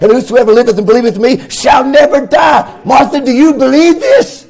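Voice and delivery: chanting sermon climax, voice ringing over the organ; full-force preaching. Acted. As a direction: whoop it up like a revival preacher.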